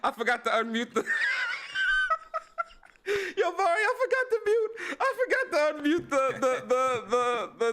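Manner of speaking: real helpless laughter, voice shooting up high; high-pitched words squeezed through laughter; laughing. Real, not acted. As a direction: crack up while admitting your slip. Host laughing over his mute mistake.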